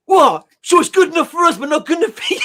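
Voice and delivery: high, loud outraged bloke; indignant drunk. Retold or imitated. Drunk geezer in a pub, high and loud with outrage. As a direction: squeal it out like an offended drunk. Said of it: "He goes".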